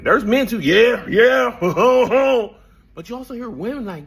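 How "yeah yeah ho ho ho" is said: imitated men whooping, loud and high; mock rowdy cheering. Speaker mimicking men in the audience, mock rowdy cheering. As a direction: cheer along like the men in the crowd.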